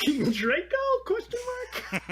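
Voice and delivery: high, loud teasing echo with "Draco" swooping up; exaggerated questioning lilt on "Draco" and "mark"; mocking upward inflection. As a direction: imitate an unsure battle cry, "Draco" rising into a question. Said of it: "Maybe not with the upward inflection at the end".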